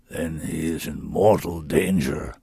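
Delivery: low, slow creature voice; grave realization from the beast; low slow solemn beast. A narrator's reading. Sorin grave.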